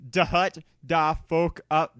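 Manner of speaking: ordinary delivery, nothing marked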